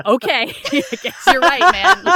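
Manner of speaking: laughing mock surrender. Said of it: "I'm like".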